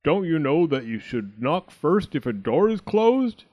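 loud, scolding bear voice; shouting Daddy Bear. A narrator's reading. Daddy Bear scolding Goldilocks, shouting. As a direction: scold loudly. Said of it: "shouted Daddy Bear".

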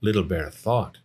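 ordinary delivery, nothing marked

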